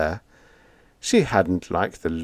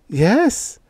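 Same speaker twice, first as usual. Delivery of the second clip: much higher, bright eager yes; higher delighted yes; 13 semitones higher, 3 dB louder than usual. A narrator's reading. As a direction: sell it with delight. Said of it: "infectious delight".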